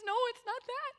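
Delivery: put-on upset little girl denying it. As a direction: play the small crying daughter. Young daughter answering through tears.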